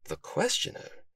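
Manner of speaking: musing question to oneself; puzzled self-questioning. A narrator's reading. Detective puzzles over the parcel's sender, puzzled. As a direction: softly wonder it aloud, ending high. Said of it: "Sylvester asked himself".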